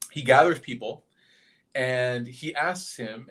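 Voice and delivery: ordinary talk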